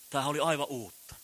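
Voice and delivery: usual speaking voice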